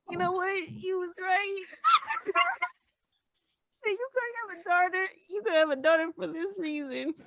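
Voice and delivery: high, choked-up voice; tearful. Real, not acted. Daughter admitting she deserves a daughter like herself, tearful. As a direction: push the joke out through tears. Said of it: "I'm gonna cry".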